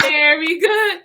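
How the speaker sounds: loud, slow cheering chant; excited cheer. Acted. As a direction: cheer it out with gusto. Laughter yoga cheer chant.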